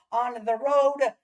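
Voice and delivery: ordinary talk, nothing marked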